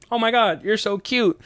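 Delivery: high-pitched gushing; cooing over pet. Retold or imitated. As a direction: coo at an adorable animal. Acts out petting the cat.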